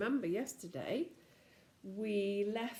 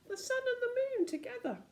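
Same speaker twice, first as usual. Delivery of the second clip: amazed. A narrator's reading. Wonder at strange sky.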